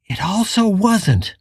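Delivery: ordinary delivery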